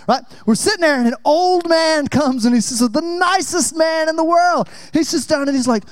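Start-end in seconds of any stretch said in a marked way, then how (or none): 2.8-4.7 high, slow shouted praise; exaggerated praise. Real, not acted. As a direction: announce him grandly. Exaggerated praise of old man.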